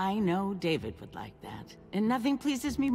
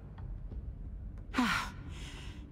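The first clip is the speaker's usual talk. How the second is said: long weary sigh; 5 semitones higher, 8 dB quieter than usual. Acted. [name] concedes Max's point.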